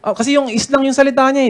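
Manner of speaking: normal talking voice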